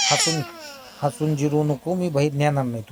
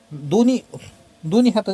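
higher, louder exasperated command; exasperated scold; 6 semitones higher, 5 dB louder than usual. Real, not acted. Parent to baby Raghav about hands in mouth, exasperated louder scold. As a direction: raise your voice, losing patience with him.

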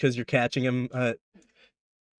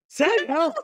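high yelp of a plea, cracking into laughter; laughing panic; 14 semitones higher than usual. Acted. Captured beaver-man pleading through the GM's laughter. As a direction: beg for help while cracking up. NPC keeps calling, GM laughing.

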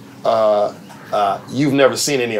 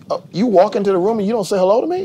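imitating Martin; 9 semitones higher, faster than usual. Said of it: "He'd be like this".

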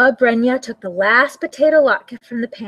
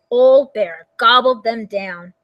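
plain reading voice

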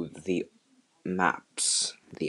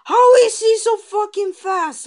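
furious yell; 5 dB louder than usual. Real, not acted. Repeated rant.

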